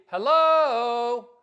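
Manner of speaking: loud, high, drawn-out hello; ringing sarcastic holler; mock obvious. Real, not acted. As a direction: call it out as if it's obvious. Mock-obvious exclamation, escalating.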